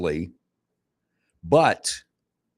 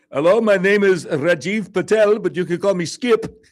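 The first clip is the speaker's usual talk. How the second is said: put-on Indian accent; 11 semitones higher, 4 dB louder than usual. Imitating Indian call center agent.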